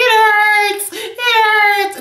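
put-on high, whiny baby voice, almost sung; babyish whimper. To her husband on the phone, whiny baby voice. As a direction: whimper it like a babied wife. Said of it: "I automatically turn into a baby".

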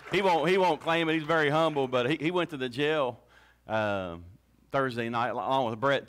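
normal talking voice

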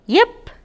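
high, sharp imitated puppy yip; a tiny dog yipping from the grass. Narrator voicing a hidden puppy, high yip.

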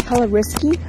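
ordinary talk, nothing marked

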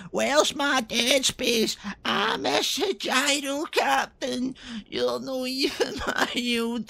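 high, slow moaning complaint; mock a sulky Jesus demanding his stream; high-pitched slow whinge about Dead Space; whiny Jesus voice. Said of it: "Jeezy peeps is downstairs getting on".

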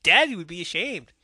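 hammed-up high taunt, punching DADDY; mocking Ace. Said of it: "It's like the way he says it".

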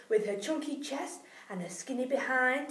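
usual speaking voice

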